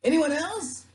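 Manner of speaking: high, loud call from a boat; loud, high-pitched shout; yelled question. Acted. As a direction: holler the question across water. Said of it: "The person on the boat yelled".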